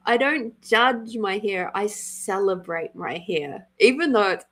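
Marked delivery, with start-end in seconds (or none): none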